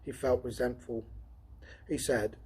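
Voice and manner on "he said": ordinary delivery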